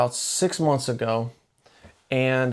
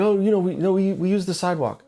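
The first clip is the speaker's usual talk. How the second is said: imitated kid, higher-pitched hedging; evasive son; 6 semitones higher, faster than usual. Son dodging dad about crossing the road, imitated evasiveness.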